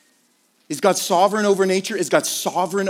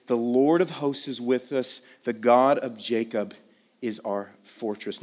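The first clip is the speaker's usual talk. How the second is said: scripture reading; 8 semitones lower, 4 dB quieter than usual. Real, not acted. Pastor reading the psalm softly to the congregation. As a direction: read it gently, like a promise.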